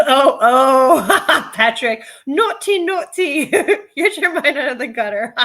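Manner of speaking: laughing tease. Real, not acted. Teasing Patrick for a naughty joke, laughing. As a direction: scold playfully while laughing.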